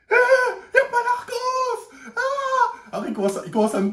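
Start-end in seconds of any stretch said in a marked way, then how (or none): 0.1-2.8 shrill, much higher, slow laughing yell; mock shriek of the cheated boy; outraged shriek. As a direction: shriek it like a kid robbed, hammed up. Boy discovers empty box.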